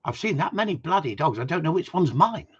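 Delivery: mock posh English accent; the Queen. Posh Queen complaining about the dogs, mock posh accent. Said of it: "Oh, she speaks posh".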